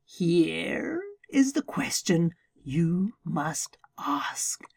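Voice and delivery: slow, secretive lizard whisper. Acted. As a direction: lean in and whisper the riddle. Lizard poses the question.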